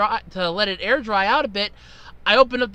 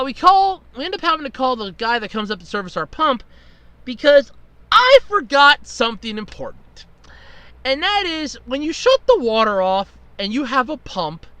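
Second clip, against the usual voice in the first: ordinary talk, nothing marked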